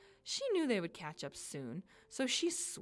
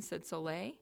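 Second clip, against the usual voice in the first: plain reading voice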